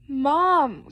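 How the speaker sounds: loud, drawn-out plaintive call; storybook wail for Mom; crying out. Acted. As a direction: call for mother, stretching the word. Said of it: "cried Froggy".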